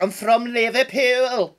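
high, drawn-out mock Scouse; exaggerated Scouse accent. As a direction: ham up a Liverpool accent, slowly. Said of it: "who told you to do that".

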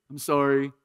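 mock half-hearted kid apology; kid's empty sorry. Pastor mimics his kids' careless sorry, half-hearted. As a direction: toss off a hollow sorry like a child. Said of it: "like my kids do".

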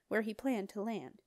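plain reading voice

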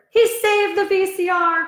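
high delighted exclamation; excited cry. Acted. Mother thrilled the dog saved the silverware, crying out high. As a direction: cry it out with joy. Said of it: "cried Father".

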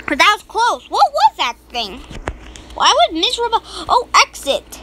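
normal talking voice

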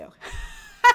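a burst of loud high laughter. Real, not acted. Laughs at running over time.

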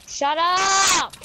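high, stretched shout; exasperated real shout; drawn-out yell. Real, not acted. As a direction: yell it long to silence someone. Repeatedly yelling at someone to shut up.

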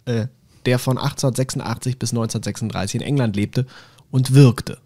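usual speaking voice

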